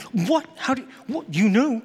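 put-on teen realizing parents knew, stunned; shocked disbelief. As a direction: caught off guard. Shocked at being caught.

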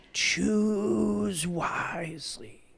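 hushed, very slow raspy whisper; whispered croak of an ancient guardian. Acted. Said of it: "that old eight hundred-year-old guard".

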